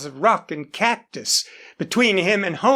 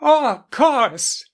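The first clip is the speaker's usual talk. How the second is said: slow, gleeful little cry; delighted, remembering her birthday; 5 semitones higher, slower than usual. Acted. Daughter remembering her birthday present, gleeful cry. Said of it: "she cried gleefully".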